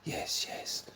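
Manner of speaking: faint breathless whisper. Acted. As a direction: whisper it as he fades. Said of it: "he whispers".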